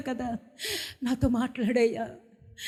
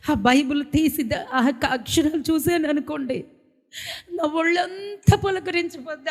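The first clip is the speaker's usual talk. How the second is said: normal talking voice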